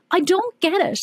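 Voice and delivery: lightly voiced indignant child; child protesting. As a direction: play the cheeky kid questioning the rule. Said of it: "I'm like".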